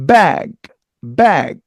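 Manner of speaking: bag with a released g, not a Korean final; English bag. Shown as a demonstration. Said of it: "기억 받침이 아니고요".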